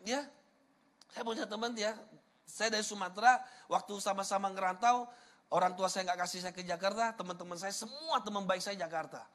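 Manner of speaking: ordinary talk, nothing marked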